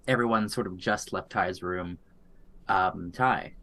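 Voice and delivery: ordinary talk, nothing marked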